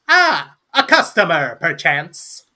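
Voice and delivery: high, loud "Ah" settling down; welcoming gnome. Acted. Gnome spotting a new customer at the bar, showy welcome. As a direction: greet a customer with theatrical flourish. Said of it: "the gnome turns to look at you and goes".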